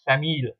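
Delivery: famille said "fameel", no s at the end; silent final S. Shown as a demonstration. Teacher showing the plural after les, silent-s pronunciation. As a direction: say famille with the written s left silent. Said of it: "you will only pronounce it as".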